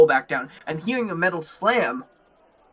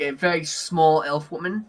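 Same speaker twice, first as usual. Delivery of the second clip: ordinary talk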